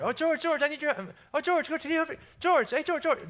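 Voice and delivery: put-on high, loud old lady calls of George; old ladies calling. Ladies calling the worker all day, high and loud.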